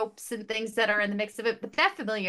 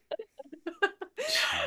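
laugh; 12 semitones higher, 3 dB quieter than usual. Real, not acted. Laughing after mock sob.

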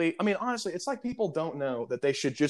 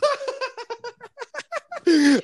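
big mocking laugh. Laughing at the customer who split the bag, high mocking laugh. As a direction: laugh right in his face. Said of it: "I went".

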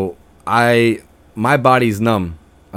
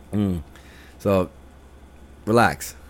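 ordinary talk, nothing marked

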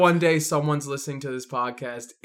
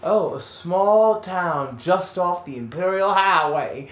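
put-on southern accent; 3 semitones higher than usual. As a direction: read it like a Southern tour guide, half laughing. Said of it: "imagining a southern person".

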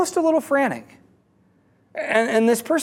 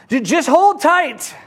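high, slow mock yell; 7 semitones higher than usual. Dangling man calling up to the one holding the rope, mock yell. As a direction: call up as if from far below. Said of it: "the man yells up".

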